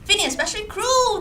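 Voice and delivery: usual speaking voice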